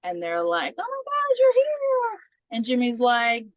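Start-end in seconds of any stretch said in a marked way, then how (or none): 0.8-2.2 hammed-up high squeal of greeting; put-on high, delighted team cheer; mock excited greeting. Teammates greeting the returning player, mock excited.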